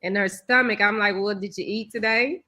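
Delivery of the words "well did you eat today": ordinary delivery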